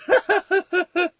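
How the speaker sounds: high, hysterical giggling fit; crazed laughing. Acted. Boy after shooting the villain, hysterical laughter. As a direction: cackle manically until it wears thin. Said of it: "Tim Drake's like".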